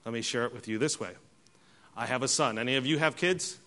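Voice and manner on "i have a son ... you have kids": ordinary talk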